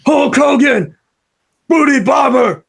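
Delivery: loud, high wrestling-villain shout; Zeus impression. Cohost asked for Zeus from No Holds Barred, high loud shout. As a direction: bellow it like a movie wrestler hamming it up. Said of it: "can you only answer like Zeus would in the No Holds Barred movie".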